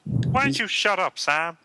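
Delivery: high frog puppet voice; Kermit the Frog. Acted. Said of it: "But Kermit's actually here".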